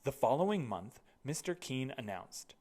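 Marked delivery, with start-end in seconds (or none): none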